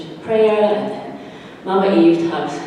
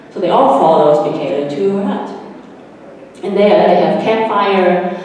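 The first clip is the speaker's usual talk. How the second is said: ordinary delivery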